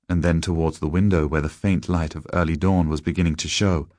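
usual speaking voice

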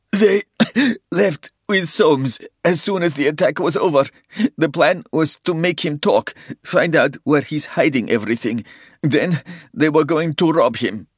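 high, loud, pained speech with cough. A narrator's reading. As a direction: gasp it out between coughs. Dying man confesses coughing blood.

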